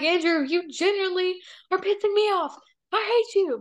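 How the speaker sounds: high, exasperated mock complaint; dramatic annoyance. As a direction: act out a theatrical tiff. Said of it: "I'm dramatic".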